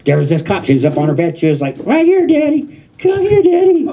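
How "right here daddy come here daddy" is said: high, breathy girlfriend impression; girlfriend's voice. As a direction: squeak it up like her. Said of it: "I think I nailed her voice".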